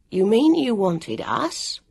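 slow, disbelieving question; incredulous. A narrator's reading. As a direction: ask it in disbelief. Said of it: "Jonathan said incredulously".

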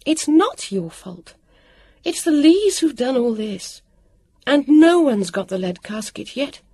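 high, exasperated scolding; cross, insistent reproach; turning on someone. A narrator's reading. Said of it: "She turned on Jonathan".

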